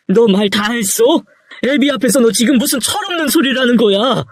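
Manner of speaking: high, indignant rebuke; outraged father. Acted. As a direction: demand to know how he dares. Father outraged by son's words.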